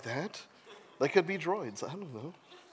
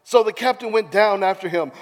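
loud, theatrical Bible storytelling; animated narrator in full swing; dramatic scripture reading; 7 semitones higher, 13 dB louder than usual. A narrator's reading. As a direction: build the storm with big, punched words. Reads scripture aloud dramatically.

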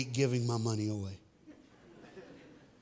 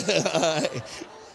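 loud open laugh; real laugh; 4 semitones higher, 4 dB louder than usual. Real, not acted. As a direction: let a full laugh burst out. Laughs after joking with audience.

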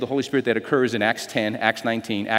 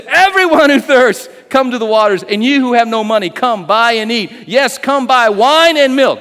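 proclaiming scripture; 8 semitones higher, 7 dB louder than usual. Acted. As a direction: proclaim it like a prophet. Proclaiming Isaiah's words.

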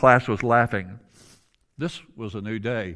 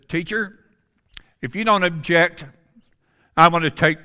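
much higher and louder, stepping up for a friend; bold call-out; 6 semitones higher, 7 dB louder than usual. Retold or imitated. Big Tom stands up and tells the teacher he takes the punishment, bold and loud. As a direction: call it out boldly and firmly.

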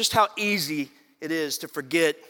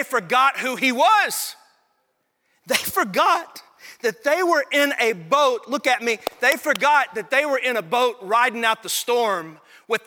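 louder, higher pulpit build; preaching at full force; 8 semitones higher, 6 dB louder than usual. Acted. Pastor urging his church, preaching at full force. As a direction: press the point to the congregation.

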